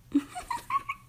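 high-pitched little giggle; a short, high burst of bashful laughter; shy giggle. Acted. Admitting the kiss was really nice, high bashful giggle.

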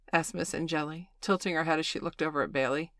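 ordinary delivery, nothing marked

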